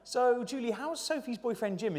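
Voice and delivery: a hint of a prying coffee-morning friend; gossiping friend. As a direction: coo it like a friend fishing for gossip over coffee. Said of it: "they say".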